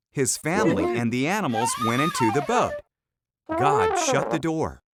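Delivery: plain reading voice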